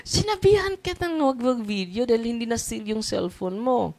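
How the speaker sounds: high-pitched exasperated telling-off; mock scolding. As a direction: play at scolding the one who filmed. Acted scolding of phone owner.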